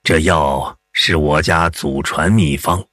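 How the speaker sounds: unhurried elder putting a patient at ease; old man. Acted. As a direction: play a reassuring old village healer. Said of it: "老人边为一时敷草药边说".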